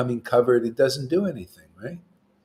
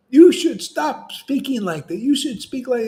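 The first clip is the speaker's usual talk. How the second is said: mock scolding devotee; 11 semitones higher, 5 dB louder than usual.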